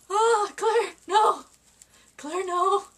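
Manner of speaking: scolding herself. Real, not acted. Tearing up on camera, yelling at herself to stop. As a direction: shout her own name to stop the tears.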